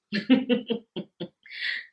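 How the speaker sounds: a sudden high laugh that breaks through; embarrassed burst of laughter. Real, not acted. Laughing while telling viewers about hospital commodes. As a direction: laugh it off, caught out by the gross detail.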